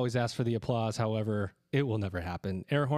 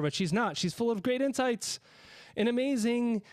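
much higher, rising into an excited guest build-up; hyped-up praise; 9 semitones higher than usual. Real, not acted. Host gushing while introducing his returning guest, excited and high. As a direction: gush about her like a big reveal.

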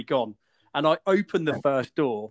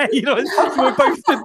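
laughing; 8 semitones higher than usual. Real, not acted. Laughing while retelling.

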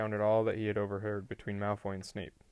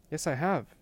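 higher, mildly startled reply; 6 semitones higher, 3 dB louder than usual. A narrator's reading. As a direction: answer with a touch of surprise. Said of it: "sounding startled".

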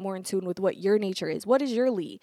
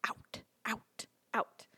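breathy whispered out; 11 dB quieter, slower than usual. Shown as a demonstration. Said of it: "Masculine is all about the expansive".

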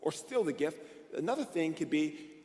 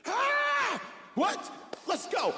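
high-pitched mock thrilled yell; esaltazione recitata; much slower than usual. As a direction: re-enact an excited outburst, voice shooting up. Acts out his thrilled realization.

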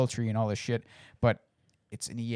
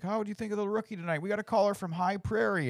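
higher, faster radio host voice; mock radio host; 10 semitones higher, 3 dB quieter than usual. Mimicking the in-game radio show host, quick and high. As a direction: rattle it off like a call-in show host. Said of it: "It's like".

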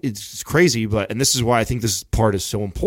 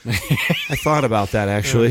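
laughing. Real, not acted. Laughs at diaper joke.

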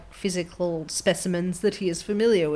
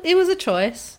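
mock shrug; 12 semitones higher, 5 dB louder than usual. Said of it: "just makes me go".

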